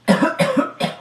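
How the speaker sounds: badly faked coughs; fake cough. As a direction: pretend to be sick. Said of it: "nilalagnat ako".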